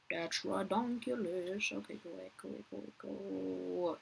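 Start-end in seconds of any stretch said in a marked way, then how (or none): 1.7-4.0 much softer, repeating go away under the breath; murmured shoo. Real, not acted. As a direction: quietly shoo them off, almost to yourself. Shooing a player away, murmured.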